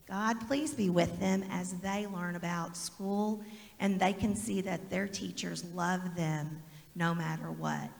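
prayer. Acted. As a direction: pray quietly over the children. Leads a prayer with the kids.

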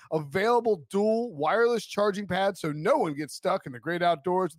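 upbeat sponsor read, pitch lifted; lilting promotional read. Acted. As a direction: sell it like a podcast car ad. Reads a sponsor ad.

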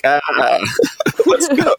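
mock pumped-up huddle yell, rising high; playful high-pitched rallying cry; imitated excitement. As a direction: act fired up out of the huddle. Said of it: "excited and telling you a point".

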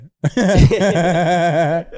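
high, loud burst of laughter. Real, not acted. Reacting to the bad day joke, loud laughing. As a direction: crack up loudly.

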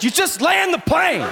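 high-pitched, hammed-up angry yell; aggressive shout. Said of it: "more aggressive".

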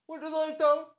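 tiny falsetto voice; very high, small voice; high character voice. A narrator's reading. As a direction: squeak it out in falsetto. High voice interjects in story.

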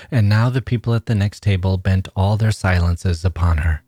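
ordinary delivery

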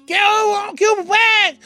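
contesta de lejos, muy agudo; respuesta a gritos. Acted. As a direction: grita desde la otra orilla. Answers across the lake. (llamado actuado de vuelta)

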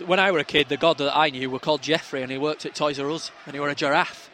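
ordinary delivery

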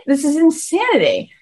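hammed-up disbelief; mock disbelief. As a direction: play up the outrage. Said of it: "I'm like".

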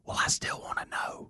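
hushed, urgent whisper. Retold or imitated. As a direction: whisper it so nobody wakes. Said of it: "Why are we whispering?".

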